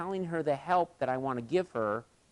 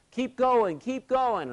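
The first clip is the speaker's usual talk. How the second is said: high, loud cheering up to a climber; retold yelling; 11 semitones higher, 5 dB louder, much slower than usual. Kids below yelling encouragement up the cable, loud and high. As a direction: call up to him: keep going.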